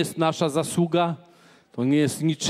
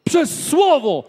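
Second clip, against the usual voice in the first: slow, higher, louder proclamation; slow ringing preacher's shout; preaching shout; 7 semitones higher, 4 dB louder, slower than usual. Acted. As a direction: call it out to the whole church, slowly. Preacher proclaims God's word.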